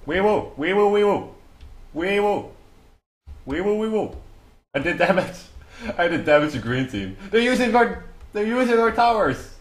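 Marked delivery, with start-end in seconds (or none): 0.1-4.1 high, loud wee woo siren wail; loud playful siren; siren imitation. Siren noise while attacking. 7.3-9.5 high, loud outraged cry; real excited outrage; indignant outburst. Real, not acted. Outraged at enemy team.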